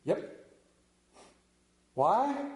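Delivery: ordinary talk, nothing marked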